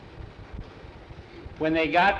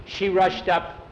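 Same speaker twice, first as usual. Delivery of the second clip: plain reading voice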